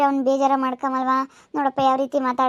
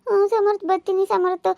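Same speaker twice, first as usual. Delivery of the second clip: mocking meek reply; 6 semitones higher than usual. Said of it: "ಅಂತ ನಿನ್ ಕಿಸಿತಿದ್ರೆ".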